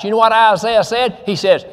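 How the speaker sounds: usual speaking voice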